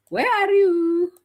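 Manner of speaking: high, stretched call across the room. Real, not acted. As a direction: sing out the call to the guest, slowly. Calling out to guest.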